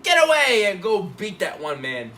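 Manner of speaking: hammed-up angry dismissal; mock shouted order. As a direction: bellow an order, playing the part. Acted order shouted in retelling.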